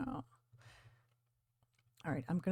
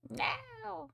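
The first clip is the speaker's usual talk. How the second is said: playful squeal; 7 dB quieter than usual. Real, not acted. Pet about to bite.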